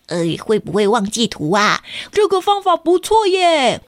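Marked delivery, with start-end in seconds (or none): 2.1-3.8 put-on high, pleased child voice; classmate voice. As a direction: say it like an approving kid. Classmate voice in story.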